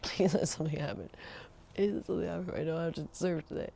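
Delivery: soft pleading murmur to herself; under-breath plea. Retold or imitated. Girlfriend secretly praying surf gets cancelled, soft pleading murmur. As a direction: beg quietly so he can't hear. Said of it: "The whole time I'm just like".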